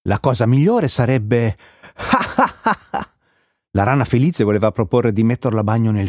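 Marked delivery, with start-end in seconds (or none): 2.0-3.0 high-pitched burst of laughter; giggling fit. A narrator's reading. Happy frog cannot finish her suggestion, helpless high laughter. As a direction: break into high, repeated ha-ha laughs. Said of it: "le veniva troppo da ridere".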